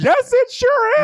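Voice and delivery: very high, loud laughing retort; giggling insistence; laughing squeal. Real, not acted. Teasing argument about ketamine.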